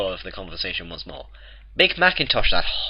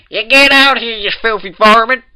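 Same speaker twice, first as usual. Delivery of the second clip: put-on much higher, much louder old mare's shout; furious shout; 15 dB louder than usual. A narrator's reading. Granny Smith yells Rarity out of the house, furious. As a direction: holler it at the intruder. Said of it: "Granny Smith shouted".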